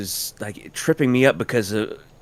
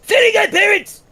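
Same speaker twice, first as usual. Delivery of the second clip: very high, loud cartoon voice; cartoon impression; 5 dB louder than usual. Friend compares voices with a squeaky impression. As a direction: squeal it out in character. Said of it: "Who also sounds like".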